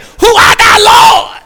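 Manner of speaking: very high, shouted plea; desperate cry. Acted. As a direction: scream the question to heaven. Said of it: "but he said".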